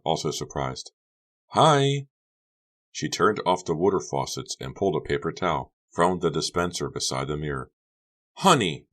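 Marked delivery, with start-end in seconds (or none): 1.5-2.1 Honey's high hi. Acted. Honey voiced, surprised. 8.4-8.9 loud mock scream of a name. Becca confronts Honey at the sink, loud mild scream. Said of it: "Becky screamed".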